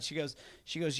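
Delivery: ordinary talk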